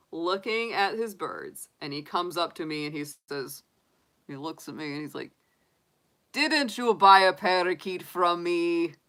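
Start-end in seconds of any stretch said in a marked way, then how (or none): 6.3-8.9 slow, loud put-on Russian accent imitating the shop owner; loud, slow mock Russian accent. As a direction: do the grumpy Russian bird seller, drawn out. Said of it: "he had the accent".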